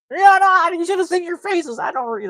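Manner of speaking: loud, very high burst that eases down; hammed-up gotcha. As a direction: squeal with glee at your own prank. Instructor's hammed-up just-kidding outburst.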